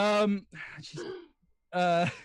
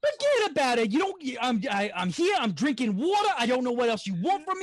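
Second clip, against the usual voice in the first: higher, heated Brooklyn rant; Brooklyn accent; 5 semitones higher than usual. Acted. Impressionist asked for New York, heated Brooklyn accent. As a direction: rattle off an irritated New York tirade. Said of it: "Brooklyn accent".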